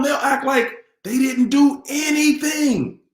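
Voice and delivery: emphatic shout. Real, not acted. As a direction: hammer the point home. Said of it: "repeated line".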